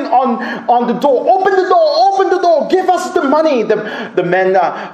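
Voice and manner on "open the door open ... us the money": high, forceful shouted order; shouted demand. Retold or imitated. Armed robbers at a caravan door, shouting. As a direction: imitate armed men yelling to get in. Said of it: "shouted in Swahili".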